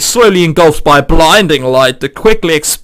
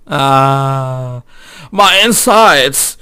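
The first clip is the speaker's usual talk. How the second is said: poisoned groan; 4 semitones lower, much slower than usual. Acted.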